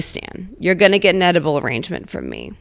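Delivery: ordinary talk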